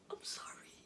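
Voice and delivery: breathless whispered apology; trembling whisper. Acted. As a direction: whisper it through tears.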